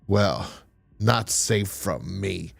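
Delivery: low and slow, leaning on not; menacing vow. Retold or imitated. Ghost's threat.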